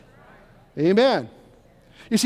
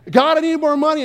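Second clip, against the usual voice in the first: a higher, louder, whiny plea to God; pleading prayer; 7 semitones higher, 4 dB louder than usual. Pretend. Preacher voicing a needy churchgoer's pleading prayer. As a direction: beg God for money, a little louder.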